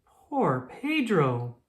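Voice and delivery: plain reading voice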